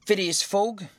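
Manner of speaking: high official summons; demanding clerk. A narrator's reading. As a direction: demand the name, lifting the pitch. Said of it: "demanded Oysterpuff".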